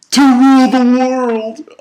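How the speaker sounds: loud, high, stretched-out mockery; mock grandiose. Reviewer ridiculing the villain's reason, mock grandiose. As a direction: proclaim it slowly like a hammy villain. Mockingly voices the villain's goal.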